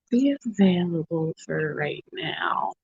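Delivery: whispering the words; soft unvoiced whisper. Real, not acted. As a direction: drop to a whisper. Quiet livestream aside.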